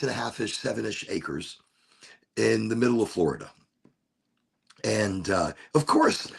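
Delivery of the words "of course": high rising "of course" in surprise; sudden realization. Real, not acted. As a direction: catch yourself mid-thought, pitch jumping up. Sudden self-aware realization.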